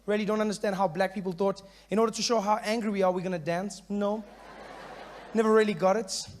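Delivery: ordinary delivery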